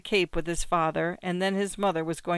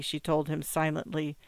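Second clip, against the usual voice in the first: ordinary delivery, nothing marked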